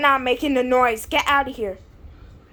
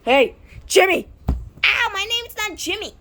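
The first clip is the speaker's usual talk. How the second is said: ordinary talk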